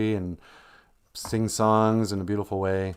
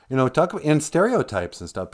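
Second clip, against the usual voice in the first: ordinary talk, nothing marked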